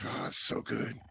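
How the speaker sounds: low breathy whisper of bliss; whispered delight. Retold or imitated. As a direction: hush it out like pure bliss. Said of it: "I was like".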